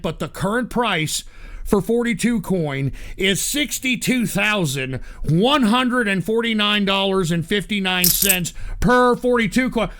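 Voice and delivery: usual speaking voice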